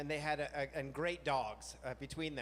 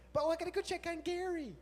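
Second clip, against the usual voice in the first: much higher, eager voice; sudden eagerness; 13 semitones higher, 3 dB louder than usual. Retold or imitated. Husband suddenly rushing off to the dog, much higher eager voice. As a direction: perk right up at the dog.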